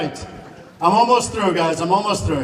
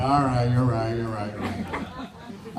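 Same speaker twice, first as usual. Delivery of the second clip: lower, slow, grudging give-in; grudging concession; 6 semitones lower, 4 dB quieter, slower than usual. Retold or imitated. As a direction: sink into a resigned admission. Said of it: "I'm like".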